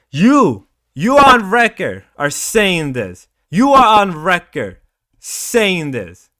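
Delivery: real heated anger, loud; angry. Real, not acted. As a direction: tear into him on record. Angrily ejects chatter on stream.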